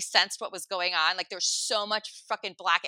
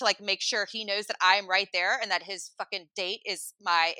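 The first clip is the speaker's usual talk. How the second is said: usual speaking voice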